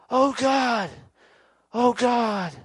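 high, mock-tearful wail; weeping angels. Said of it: "Weeping and crying and going".

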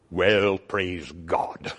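put-on low, slow old preacher drawl; imitating Brother Hibbard. Young preacher imitating his old pastor, low and slow. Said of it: "he talked like this".

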